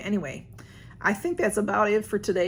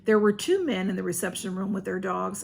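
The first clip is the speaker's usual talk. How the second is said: ordinary delivery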